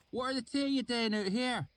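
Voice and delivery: wary rustic voice, high; thick valley accent, questioning; suspicious. A narrator's reading. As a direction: swineherd distrusting two strangers. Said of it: "he said suspiciously".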